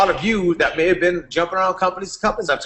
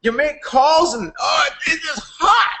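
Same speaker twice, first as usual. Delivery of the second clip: much higher, hyped-up pitch; excited Aaron impression; 13 semitones higher, slower than usual. Aaron pitching his latest company to Rich, hyped-up. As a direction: play an overexcited friend pitching a deal. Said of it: "Aaron would just pop up".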